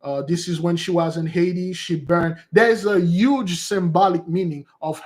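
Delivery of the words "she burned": usual speaking voice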